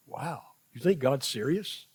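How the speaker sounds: usual speaking voice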